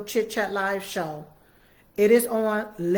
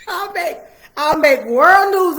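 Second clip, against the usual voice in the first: laughing; 9 semitones higher, 12 dB louder than usual. Real, not acted. Joking about pulling off her wig.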